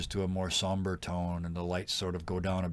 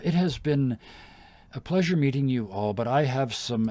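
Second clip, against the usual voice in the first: lightly voiced noble lady, higher and louder; a hint of a gracious queen; Sarissa speaking; 5 semitones higher, 6 dB louder than usual. Queen taking leave of the party, gently voiced.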